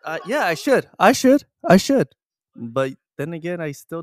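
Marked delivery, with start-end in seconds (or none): 0.0-2.1 excited. Real, not acted. Loud mock agreement.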